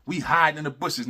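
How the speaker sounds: ordinary delivery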